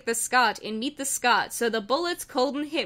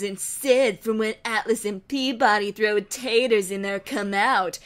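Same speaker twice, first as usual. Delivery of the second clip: louder, gloating evil twin; smug villain voice; 4 dB louder than usual. Acted. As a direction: savour every word of the threat. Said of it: "Asaram goated".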